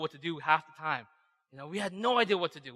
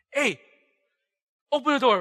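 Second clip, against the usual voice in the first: loud, raised-pitch order through a door; shouted demand; 8 semitones higher, 10 dB louder than usual. Acted. Youth teacher at the boy's door at night, shouting. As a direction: yell it like you're pounding on the door. Acts knocking angrily at a door.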